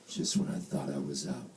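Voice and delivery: hushed, rasping movie-gangster impression; quiet mock tough-guy lament; Al Pacino. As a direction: growl it low and soft, dramatically. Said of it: "I was like Al Pacino".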